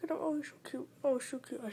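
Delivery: a high, soft whisper of delight; gushing whisper. Real, not acted. Whispering to viewers about her tiny hamster. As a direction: whisper so as not to scare him. Cooing over the frozen hamster.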